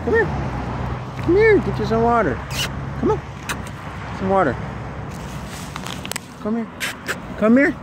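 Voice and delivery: high, sing-song call to a dog; coaxing dog call. Real, not acted. Calling a starving stray dog over for water, high coaxing voice.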